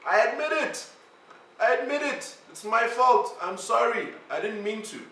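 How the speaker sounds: high, pleading mock apology; squeaky imitation of a leader owning up; mock confession. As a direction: whine it like a caught child.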